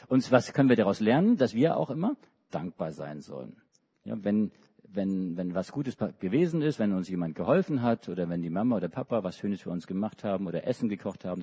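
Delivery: normal talking voice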